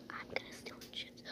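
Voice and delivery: fast, fully whispered scheming; sneaky whisper. Pretend. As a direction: hiss the plan in secret.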